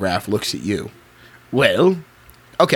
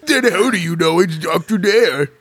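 monster voice; 6 semitones higher than usual. Acted. Monster asks detective.

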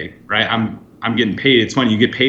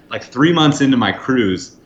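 ordinary delivery

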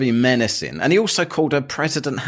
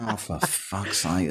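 exasperated mutter; 4 semitones lower, 9 dB quieter, slower than usual. Retold or imitated. Exasperated inner thought.

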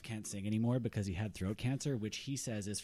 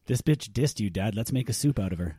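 loud, punchy outrage; indignant outburst; 10 dB louder than usual. Acted. Son defending his rejected dad, loudly indignant. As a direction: blurt it out loudly on Dad's behalf.

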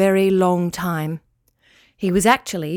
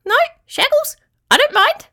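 very high, louder squeak; piercingly high little creature voice; squeaky claw; 4 dB louder than usual. A narrator's reading. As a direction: squeak it out as a tiny critter.

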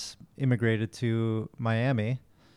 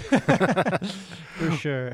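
laughing; 6 semitones higher, 3 dB louder than usual. Real, not acted. Laughs about terrible childhood demos.

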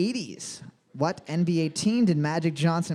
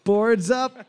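excited host call; 7 semitones higher, slower than usual. Acted. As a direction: announce it like a game show host.

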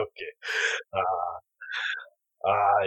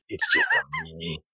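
creepy whisper; 10 semitones lower, 5 dB quieter than usual. Acted. Arnie alone with Leo.

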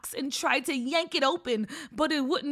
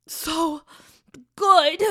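in a trance; 3 semitones higher, 8 dB louder, slower than usual. Acted. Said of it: "kind of in a trance".

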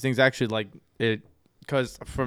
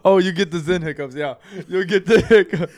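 laughing; 4 semitones higher, 7 dB louder than usual. Real, not acted. Laughing at friend's hiccups.

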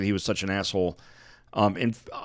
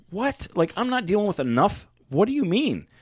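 incredulous outburst; 10 semitones higher than usual. Retold or imitated. Patient stunned when nurse says she must call 911, high incredulous outburst. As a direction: pitch jumps way up in retold disbelief.

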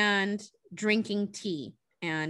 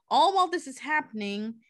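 ordinary talk

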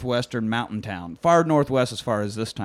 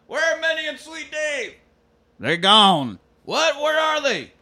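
much higher, insistent questions; high demanding cowboy; slower than usual. Retold or imitated. Kurt Russell's character demanding where the owners are, high and insistent. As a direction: press him for answers.

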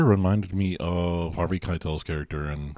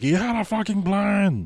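imitating a yell, pitched much higher; mock yell; 12 semitones higher, slower than usual. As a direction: retell the angry shout in a high voice. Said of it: "he yells at him".

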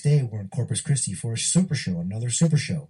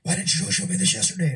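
much higher, startled boss voice; 8 semitones higher than usual. Retold or imitated. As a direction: play the boss caught off guard, pitched way up. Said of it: "with his [name] voice".

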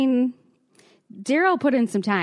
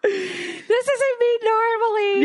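high, slow, laughing mock-mortified whine; embarrassed drunk self; 13 semitones higher, much slower than usual. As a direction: whimper it like a blacked-out girl ashamed. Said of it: "I'm like".

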